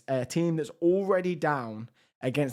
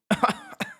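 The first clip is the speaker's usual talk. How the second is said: voice crack. Real, not acted. Commentating the match when his voice breaks. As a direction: voice cracks up into a squeak mid-word. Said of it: "the voice crack".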